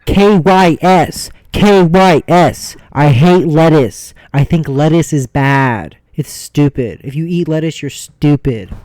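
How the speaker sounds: very loud, drawn-out proclamation; shouted rant. Pretend. Host denounces all lettuce eaters in a loud shouted rant. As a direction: yell it at full force, word by word.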